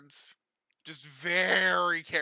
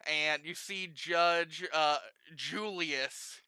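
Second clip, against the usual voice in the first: ordinary talk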